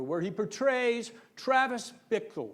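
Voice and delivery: ordinary talk, nothing marked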